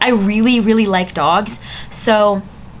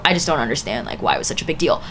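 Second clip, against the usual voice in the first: trailing off; 8 semitones lower, 6 dB quieter, faster than usual. Real, not acted.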